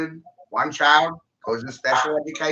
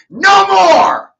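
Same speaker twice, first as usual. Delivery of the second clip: sudden cry of no more, much higher and louder; furious shout; 13 dB louder than usual. Real, not acted. Ranting at other states about their trash, a furious shout. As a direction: bellow it out to end the rant.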